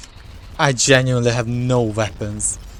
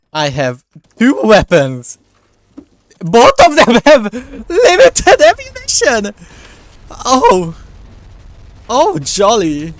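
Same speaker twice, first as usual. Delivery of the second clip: exasperated outburst; 9 semitones higher, 11 dB louder, slower than usual. Real, not acted. Exasperated at limited ammo.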